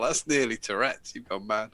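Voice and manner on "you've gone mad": usual speaking voice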